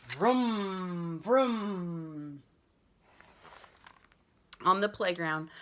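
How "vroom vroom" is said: drawn-out revving vroom; boy driving a truck; engine vroom. Acted. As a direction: rev it like a toy truck.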